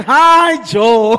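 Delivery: rich wife voice. Shown as a demonstration. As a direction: coo his name like a seductive rich wife. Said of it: "can you try to imitate?".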